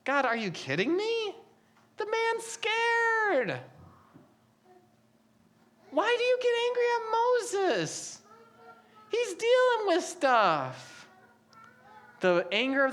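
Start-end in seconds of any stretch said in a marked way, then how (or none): 0.0-11.1 higher, softer, pleading disbelief; protesting to God. Retold or imitated. Reader arguing with God about scared Moses, mock pleading. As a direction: plead with God, half joking. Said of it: "I read that, I think".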